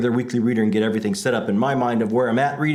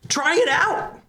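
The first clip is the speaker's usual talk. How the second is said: high-pitched pleading yell, much higher; screaming lunatic; 12 semitones higher, slower than usual. Acted. Host begging listeners to subscribe, a screaming lunatic. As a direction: beg them to try it, wild and high. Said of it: "Like a screaming lunatic".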